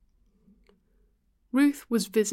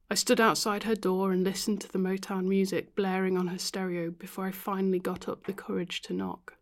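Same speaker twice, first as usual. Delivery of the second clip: ordinary delivery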